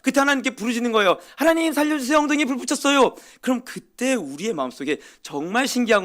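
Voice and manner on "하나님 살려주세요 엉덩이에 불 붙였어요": high, pleading yelp to God; desperate cry for help. Retold or imitated. Believer in pain crying out to God, high desperate plea. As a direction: beg God to save you, half joking.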